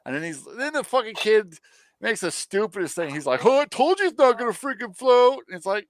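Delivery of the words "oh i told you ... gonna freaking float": high, loud put-on taunt; gloating kid. Kid gloats after sinking the boat.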